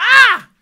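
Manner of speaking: sudden high loud shriek; startled shriek; panicked shriek. Shown as a demonstration. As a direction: shriek as if nearly losing control. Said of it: "It's a shriek".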